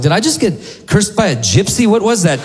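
high-pitched bewildered questions; retold shocked reaction; dumbstruck. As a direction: ask in stunned disbelief, voice jumping up. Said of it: "I was so dumbstruck".

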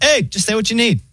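high-pitched, breezy AI voice; chill robot operator. Acted. Pharmacy phone AI greets a caller, chill. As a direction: chirp it like a laid-back automated assistant. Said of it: "like, so chill".

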